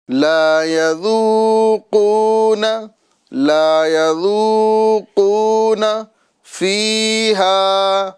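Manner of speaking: slow, melodic tajweed chant; Quranic recitation. Acted. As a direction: recite it measured and drawn out. Recites verse.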